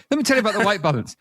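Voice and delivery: a taunting echo, quick and high; mock echoing lecture. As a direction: rattle it off like a memory on loop. Echoing in her mind.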